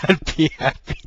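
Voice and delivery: high laughing burst. Real, not acted. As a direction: laugh through the words. Laughs hard at his own joke.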